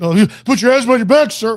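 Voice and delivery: high, loud, fast mock police order; mock cop. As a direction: play a cop barking an arrest order, quickly. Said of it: "they just get".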